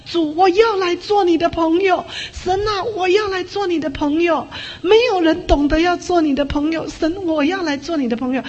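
crying out to God. Retold or imitated. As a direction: cry out to God kneeling in the snow. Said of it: "一直的跟主呼喊说".

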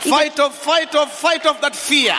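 preaching at full force. Acted. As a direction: call the crowd to fight. Shouted call to fight.

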